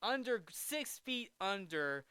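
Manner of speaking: ordinary talk, nothing marked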